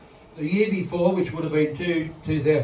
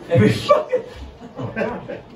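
breaking up laughing mid-line; can't get the words out for laughing; high wheezing laugh through the words; laughing fit; 7 semitones higher than usual. Real, not acted. Comic cracks up mid-joke.

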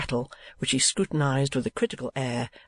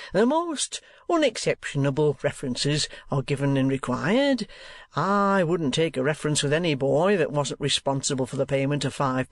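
pompous, wheedling schoolmaster. Acted. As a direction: sell the school like a smug showman. Squeers boasting to a stranger.

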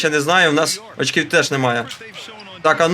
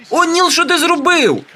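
can't believe what Neal just did; exasperated shock at a teammate; shocked disbelief; 7 semitones higher than usual. Real, not acted. Player missed a dunk.

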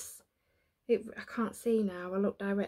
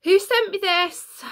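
higher, louder, slow playful call-out; excited demand; 10 semitones higher, 9 dB louder, slower than usual. Real, not acted. Calling out to the anonymous gift sender, excited. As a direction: shout it out to the mystery gifter.

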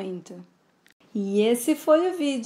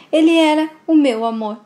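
crying out; 5 semitones higher, 8 dB louder than usual. Acted. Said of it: "Bárbara chora muito".

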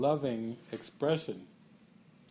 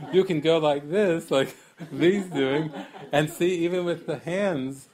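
louder, higher, words through laughter; laughing while talking; 4 semitones higher, 8 dB louder than usual. Real, not acted. Teacher laughing as he shows the hands. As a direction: talk through real laughter.

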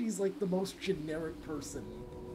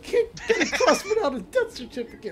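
laughing; 9 semitones higher, 6 dB louder than usual. Real, not acted. Laughing at the joke.

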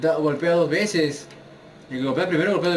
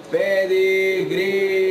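drawn-out, louder finisher call; dramatic announcement; 4 semitones higher, 5 dB louder, much slower than usual. Acted. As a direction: stretch out the move name like a ring announcer. Announcing his finisher move.

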